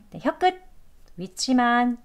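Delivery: ordinary talk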